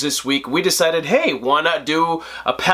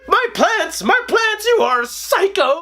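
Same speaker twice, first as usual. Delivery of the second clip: shrill high hammed-up Poison Ivy, much higher; mock Poison Ivy; 14 semitones higher than usual. Reviewer mocking Uma Thurman's Poison Ivy, high hammy voice. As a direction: overplay her fussing.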